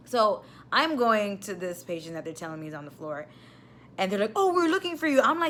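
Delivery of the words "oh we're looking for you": mocking aide. Mocking the aides.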